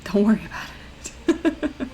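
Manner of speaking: soft slow whisper. Real, not acted. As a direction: whisper it as a secret. Whispered aside.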